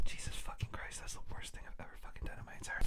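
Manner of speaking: hushed embarrassed whisper. Real, not acted. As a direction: whisper under the breath.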